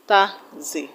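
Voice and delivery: "taxi" split in two, ta, then zi; teacher pausing inside "taxi" between syllables. Shown as a demonstration. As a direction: break "taxi" into two separate beats. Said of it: "has two syllables".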